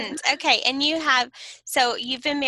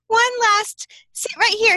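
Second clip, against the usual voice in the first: pleading mom; 7 semitones higher, 6 dB louder than usual. Retold or imitated. Retold plea to son.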